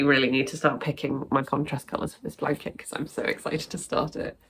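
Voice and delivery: usual speaking voice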